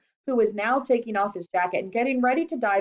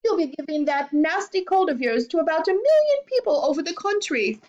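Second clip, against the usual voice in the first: high, fussing mother; high-pitched motherly nagging; scolding mother; 8 semitones higher than usual. A narrator's reading. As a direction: scold in a raised, fretful pitch. Mother scolds her son, alarmed.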